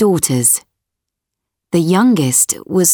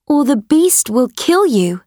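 higher, earnest daughter voice; pleading daughter, higher pitch; young girl voice; 6 semitones higher than usual. Acted. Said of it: "she said".

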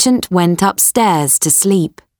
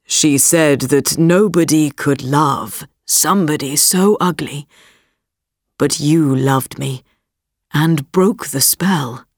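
prince voice; 5 semitones lower than usual. Acted. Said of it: "said the prince".

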